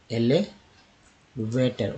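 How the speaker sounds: Elevator stretched slowly, टर clear; slow syllable demo. Shown as a demonstration. Teacher slowly modelling elevator. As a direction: draw out elevator.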